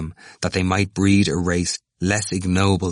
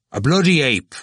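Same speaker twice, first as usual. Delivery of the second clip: high, loud punch on "bloody"; emphatic; 8 semitones higher, 5 dB louder than usual. A narrator's reading. Cranley repeating his insult to Glynn, emphatic. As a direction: hit "bloody" hard and high, falling away on "ape". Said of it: "repeated with emphasis".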